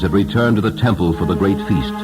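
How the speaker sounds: plain reading voice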